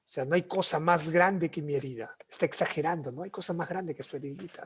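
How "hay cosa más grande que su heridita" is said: whispered teasing aside; softer whispered quip; mock whisper. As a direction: lean in and whisper the joke. Teasing aside about exaggeration.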